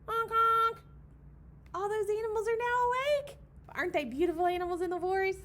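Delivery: loud high excitement; excited high and loud. Real, not acted. Delighted storyteller exclaiming to the children, loud and high. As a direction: burst out with delight.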